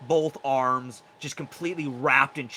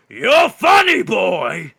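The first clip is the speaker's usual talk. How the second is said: menacing minotaur; 12 dB louder than usual. Acted. Caged minotaur warning his guard of escape, menacing. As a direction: roar it, then ease down into a dark promise.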